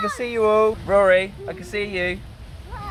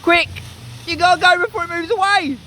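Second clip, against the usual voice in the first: urgent encouraging shout; 14 semitones higher, 4 dB louder than usual. Real, not acted. Urging child across frog stepping stones.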